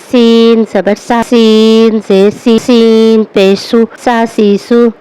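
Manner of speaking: sharp whistling س in سا سی سو; whistled س. Shown as a demonstration. Teacher showing the whistling س to pupils. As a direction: let the س of سی hiss. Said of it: "سین میں سیٹی کی آواز آئے گی".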